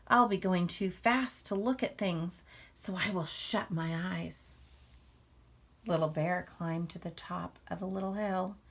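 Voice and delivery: lively, expressive read-aloud to a class; reading to children. A narrator's reading. Teacher reading a picture book to the class, lively read-aloud. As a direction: draw young listeners into the story.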